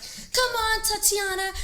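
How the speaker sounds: high, loud gym-instructor call; instructor yelling. Retold or imitated. Spin coach calling to a rider, a high mock yell. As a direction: shout encouragement like a spin coach. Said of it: "She's like".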